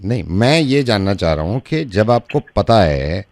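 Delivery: low, soft, slow questioning; probing doctor. Acted. Prank doctor asks man why, low probing voice. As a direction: press gently in a deep doctor's voice.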